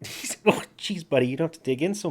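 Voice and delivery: yelp-laugh through the sting; pained laugh. Real, not acted.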